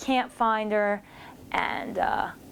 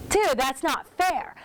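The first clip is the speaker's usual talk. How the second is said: mock whiny protest; 6 semitones higher, slower than usual. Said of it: "And we're like".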